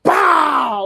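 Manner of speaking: high, slow line then loud shouted bow; mock promo with a shouted bow; imitating the warning shot. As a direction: ham up the cringe gunshot. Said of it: "he goes ... Like that".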